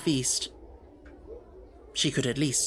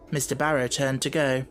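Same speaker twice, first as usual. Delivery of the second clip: plain reading voice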